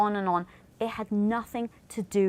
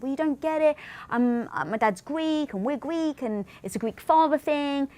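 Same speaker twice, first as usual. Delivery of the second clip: higher, insistent explanation; defensive explaining; 6 semitones higher than usual. Retold or imitated. Husband replaying how he explained the Greek father thing. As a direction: justify yourself a bit sheepishly.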